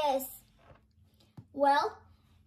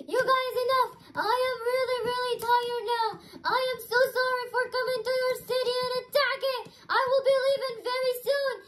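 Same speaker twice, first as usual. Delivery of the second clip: beaten villain; 6 semitones higher, faster than usual. Pretend. Defeated Venom apologising to the heroes, higher and hurried. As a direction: beg off fast and give up.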